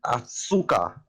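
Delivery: sharp Russian curse as if he stubbed a toe; pained Russian yelp. Acted. Soldier fakes a stubbed toe to lure the guard, pained Russian curse. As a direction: yelp like you just stepped on your toe. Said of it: "it sounds like I stepped on my toe".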